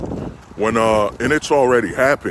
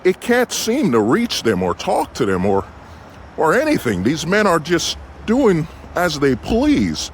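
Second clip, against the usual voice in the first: higher, earnest TV newscaster delivery; news reporter voice; 3 semitones higher than usual. Pretend. Vlogger parodying a news reporter, mock newscaster voice. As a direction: play a breathless news anchor on the scene. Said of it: "put my news reporter voice".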